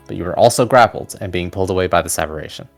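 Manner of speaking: usual speaking voice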